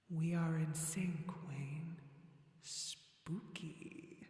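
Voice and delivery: slow, echoing ghostly voice fading to a whisper; spooky voice. Pretend. Streamer teasing Wayne about being in sync, spooky voice. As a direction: play spooky, drawing the words out slowly. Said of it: "my spooky voice".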